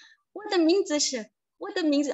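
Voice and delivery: put-on Indonesian-Javanese accent. Showing how Indonesian learners say their name, Indonesian-Javanese accent. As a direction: imitate an Indonesian-Javanese learner's Mandarin with mismatched tones. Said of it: "logat Indonesia dan juga logat Jawa".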